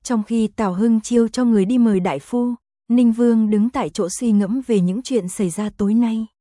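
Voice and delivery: plain reading voice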